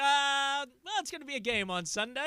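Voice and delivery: high, bright mock-upbeat sportscaster lilt; cheerful mock hype; forced positivity. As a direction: talk yourself into hope. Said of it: "You were looking for positivity".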